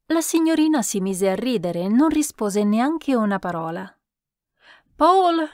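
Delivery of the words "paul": high, loud call. A narrator's reading. Countess calls Paul from behind the screen, shouting. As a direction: call out sharply for someone in another room. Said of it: "gridò la contessa da dietro il paravento".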